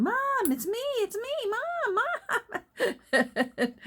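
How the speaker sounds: yelling at the phone. Retold or imitated. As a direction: call out so mom hears you through the screen. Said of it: "I'm yelling at it".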